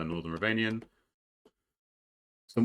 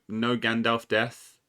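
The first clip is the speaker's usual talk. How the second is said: ordinary talk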